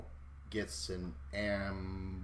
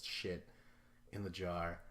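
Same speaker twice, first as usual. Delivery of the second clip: usual speaking voice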